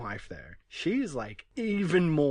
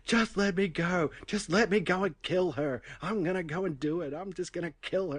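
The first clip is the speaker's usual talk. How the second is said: higher-pitched mock frenzy, easing in volume; imitated screaming threat; 7 semitones higher than usual. Podcaster retells a man screaming about his wife, mock frantic. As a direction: act out a raving man, short of a real scream. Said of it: "he's actually screaming about killing her".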